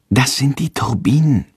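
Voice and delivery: usual speaking voice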